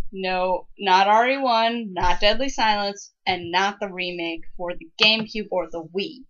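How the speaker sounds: ordinary talk, nothing marked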